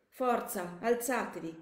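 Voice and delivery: moderately loud, distressed soldier's shout; desperate shouted order. Acted. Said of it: "urlò il ragazzo".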